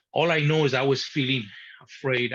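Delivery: ordinary delivery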